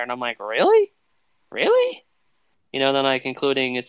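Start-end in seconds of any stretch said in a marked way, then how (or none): 0.4-0.9 rising surprised question. Retold or imitated. Repeated disbelief at the cast. 1.5-2.0 very high squeaky disbelieving question; incredulous. Retold or imitated. Repeated disbelief, pitched far higher.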